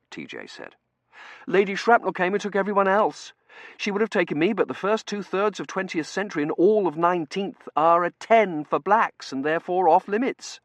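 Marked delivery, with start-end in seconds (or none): 1.5-10.6 high, loud explanation; earnest young junior. Acted. T J explaining why he was left behind, high earnest voice. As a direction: explain eagerly in a raised voice.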